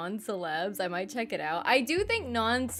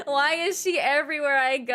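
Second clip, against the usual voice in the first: louder, laughing, fed-up complaint; laughing exasperation; 4 semitones higher, 6 dB louder than usual. Retold or imitated. Streamer complaining Lisa is everywhere, laughing. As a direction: whine it through laughter.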